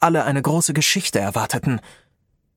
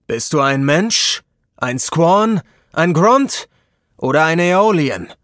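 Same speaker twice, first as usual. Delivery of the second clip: wizard leader; 3 semitones higher, 5 dB louder, slower than usual. A narrator's reading. Leader questions, rising volume.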